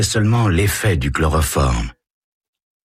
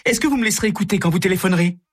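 much higher, fast and eager; excited gushing; 14 semitones higher, much faster than usual. Acted. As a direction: rattle it off thrilled. Carole gushes excitedly.

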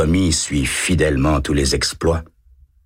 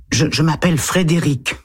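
put-on higher boyish voice, a touch hesitant; little boy voice; 12 semitones higher than usual. A narrator's reading. Boy answering the lieutenant, higher child voice. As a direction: read it as a timid eight-year-old.

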